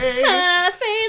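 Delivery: high, loud dreamy voice; high spacey voice. Pretend. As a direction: float off into space.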